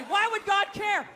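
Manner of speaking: normal talking voice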